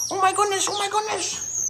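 very high squeal; high-pitched gush. Real, not acted. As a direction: melt over the otter. Squealing over otter.

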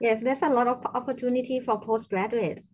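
loud, projecting voice. Real, not acted. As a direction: speak up so they can hear. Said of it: "can, can you speak louder".

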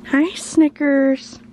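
sweet high cat-greeting voice; pet talk. Real, not acted. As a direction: coo a greeting to the kitty.

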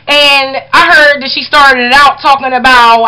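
normal talking voice

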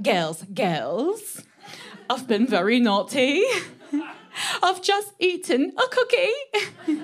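put-on confessional British accent, rising high and slow on cookie; giggly British accent, slow at the end. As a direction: play a British girl owning up to a tiny sin, in a British accent. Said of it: "British girl would always come in like".

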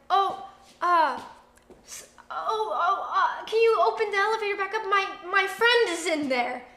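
flustered, fast, laughing a little. Retold or imitated. Panicked request at elevator.

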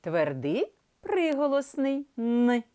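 ordinary talk, nothing marked

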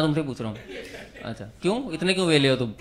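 normal talking voice

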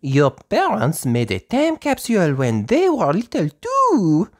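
high, slow, fluttery French teacher voice; a gentle teacher speaking slowly to little children; Madame Gazelle voice. Acted. Teacher to her playgroup, high and slow.

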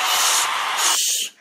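whispered shh to hush the crowd; breathy hush to the audience; shushing. Pretend. Hushing crowd.

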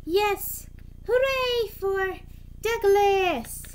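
high, loud, drawn-out hurrah; cheering. A narrator's reading. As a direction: cheer as a jubilant crowd of engines, stretching it out. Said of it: "All the engines cheered".

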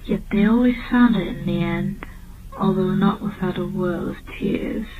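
plain reading voice

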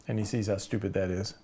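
ordinary talk

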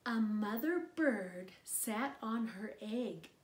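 put-on gentle read-aloud voice for a little child; storybook narration. Narrator reading a picture book to a child, gentle read-aloud. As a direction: read it like bedtime story time.

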